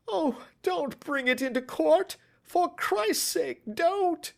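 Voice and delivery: high, loud, frantic begging; desperate pleading. Acted. Thief grovelling at the detective's knees, frantic. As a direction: plead as if your life hangs on it.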